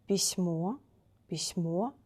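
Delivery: письмо stressed on final о, rising; stress on last O. Shown as a demonstration. Teacher gives the singular example, final stress. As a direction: say pisMO, weight on the end. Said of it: "first vowel should be stressed. We change place".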